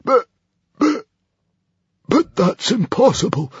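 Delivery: high, halting stammer with long gaps; stammering disbelief. A narrator's reading. Librarian sees his arrow fail, stammering. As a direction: stammer it out, aghast. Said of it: "stammered the hairy, scary librarian".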